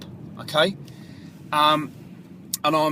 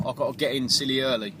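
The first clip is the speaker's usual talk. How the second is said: ordinary talk, nothing marked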